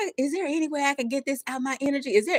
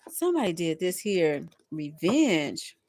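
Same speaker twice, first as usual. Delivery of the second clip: normal talking voice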